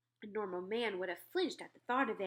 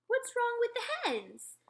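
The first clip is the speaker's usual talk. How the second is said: much higher, slow indignant question; the Queen; 4 dB louder, slower than usual. Acted. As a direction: royal surprise.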